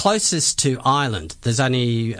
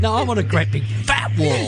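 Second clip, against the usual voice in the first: mock demand; 10 semitones higher than usual. Mock demand for bigger wall.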